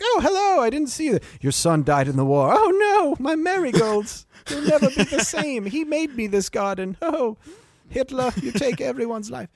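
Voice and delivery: put-on very high, quavering old lady in a garden; cliche sixty-year-old woman. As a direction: ham up a grieving old woman fussing over marigolds. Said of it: "That's a cliche sixty-year-old woman thing".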